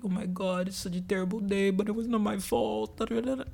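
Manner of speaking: soft, mock self-pitying whine; feeling sorry for myself. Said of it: "I was, like, feeling sorry for myself".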